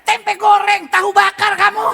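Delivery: loud, high, yelled food insult; hammed-up furious shout; mock angry Batak curse. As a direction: yell it like a curse. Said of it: "Kan sama aja kayak".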